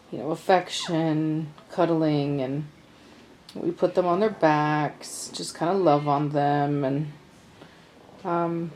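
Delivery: normal talking voice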